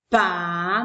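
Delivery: ordinary delivery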